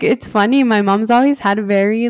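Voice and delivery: ordinary delivery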